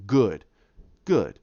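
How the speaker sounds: low-voiced dark overlord, only lightly done; deep gravelly villain. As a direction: drop low for two slow-spaced villain words. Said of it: "It's a deep-rooted voice, very gravelly".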